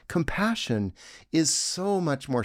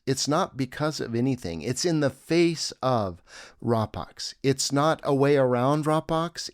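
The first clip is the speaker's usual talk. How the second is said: ordinary delivery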